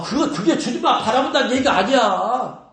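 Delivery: ordinary delivery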